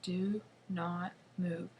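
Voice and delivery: very slow, each word spaced; telling herself very slowly not to move; slow self-command. Acted. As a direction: drag out every word to hold still. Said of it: "I tell myself".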